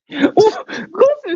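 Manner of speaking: high squealing laugh; shrieking laugh. Real, not acted.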